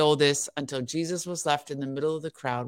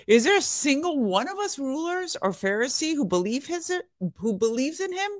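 higher, sneering Pharisee voice; mocking Pharisees; 10 semitones higher than usual. A narrator's reading. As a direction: scoff at the guards as a proud Pharisee. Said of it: "the Pharisees mocked".

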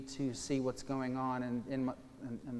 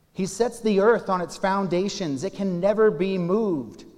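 much louder and higher, forceful declaration; proclaiming scripture; 6 semitones higher, 13 dB louder than usual. Acted. Pastor reads that the earth can never be moved, proclaiming forcefully. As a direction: thunder it out as unshakable truth.

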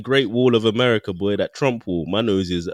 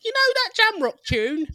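much higher put-on tourist voice; mock European tourist. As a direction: ask like a clueless holidaymaker. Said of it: "The European people are like".

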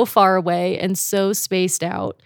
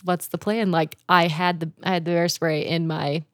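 ordinary talk, nothing marked

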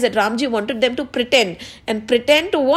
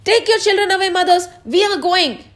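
high, raised storyteller's character voice; ordering them off; 7 semitones higher than usual. Acted. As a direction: send them away in a high, firm voice.

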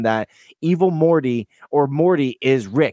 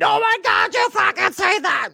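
mock-hysterical fanboy squeal; excited squeal. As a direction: shriek it like a thrilled fan. Retold overexcited outburst.

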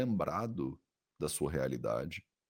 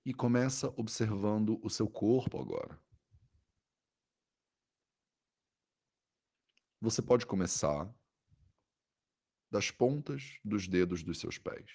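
soothing guided meditation. Acted. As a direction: guide the body scan gently, with long pauses.

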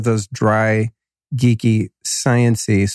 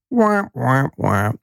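mock crybaby wail; slower than usual. Host jokes to listeners about being sick, mock wail. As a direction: whine like a pouting baby, then drop it.

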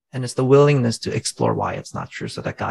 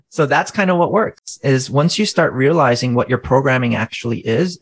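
usual speaking voice

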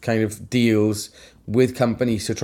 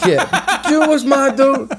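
high, loud laughing talk; 13 semitones higher, 4 dB louder than usual. Real, not acted. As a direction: laugh the words out. Laughing through podcast banter.

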